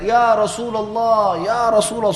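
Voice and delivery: slow, high, loud call from far away; deliberate loud call. Retold or imitated. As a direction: call out across a crowd on purpose. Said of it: "suara keras sengaja". (seruan keras dari kejauhan)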